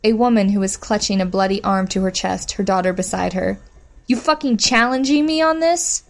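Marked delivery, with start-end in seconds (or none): 4.1-6.0 angry challenge. Acted. Bitch threatens captive woman.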